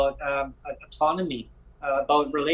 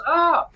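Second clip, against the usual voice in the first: startled squeak; 13 semitones higher, 5 dB louder than usual. Retold or imitated. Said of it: "She goes".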